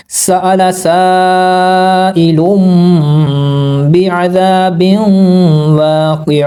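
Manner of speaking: slow melodic tilawat of सआला साइलुम; Quran recitation. Shown as a demonstration. Teacher reciting the verse correctly for students, slow and melodic. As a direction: chant it, drawing out the long vowels. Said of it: "recites again".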